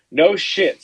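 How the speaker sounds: blurted out in disbelief; a loud, stunned no shit; amazed. Real, not acted. Stunned at dead goose.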